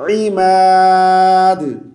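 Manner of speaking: इमाद with pharyngeal start, very long aa; throaty ain. Shown as a demonstration. Teacher stresses it is ain, इमाद chanted long. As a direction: squeeze the ain, then hold इमाद long. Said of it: "आइन है".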